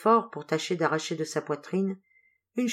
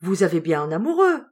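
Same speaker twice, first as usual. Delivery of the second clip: cheerful; 6 dB louder than usual. A narrator's reading. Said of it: "d'un ton enjoué".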